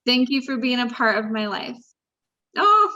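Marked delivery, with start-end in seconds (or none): 2.6-2.9 choked up, laughing it off; getting emotional. Real, not acted. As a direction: voice lifting high, half laughing with emotion. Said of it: "I'm getting emotional".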